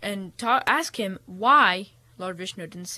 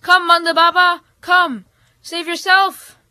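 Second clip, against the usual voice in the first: high, raised calling; yelling; 8 semitones higher, 6 dB louder than usual. A narrator's reading. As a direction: call across the water to him. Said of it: "everybody in the boat yelled".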